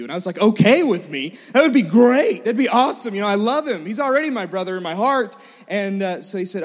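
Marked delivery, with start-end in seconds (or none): 0.4-5.3 loud, incredulous, gushing yes; overjoyed excitement. Retold or imitated. Boy answers dad about adopting little brother, thrilled. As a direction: burst out eagerly, voice jumping up. Child reacts to adoption question.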